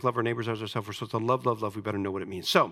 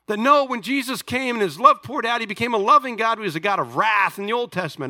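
high, loud preaching to the church; full-force preaching; 7 semitones higher, 5 dB louder than usual. Acted. Preacher driving home a point to the congregation, loud and high. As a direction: pastor preaching to his church, raised and loud.

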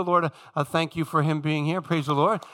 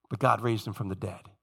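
"God" weighted, low and quieter; soft declaration; 11 semitones lower, 6 dB quieter than usual. Retold or imitated. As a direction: state it softly, letting "God" carry. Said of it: "this theme constantly".

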